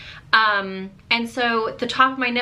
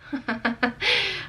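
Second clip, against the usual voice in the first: high laugh; 8 semitones higher, 5 dB quieter than usual. Real, not acted. Laughs at own outburst.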